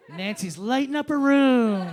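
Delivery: sing-song mock awe; mocking gush. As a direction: stretch it out in fake admiration. Sarcastically mocks admired Nancy.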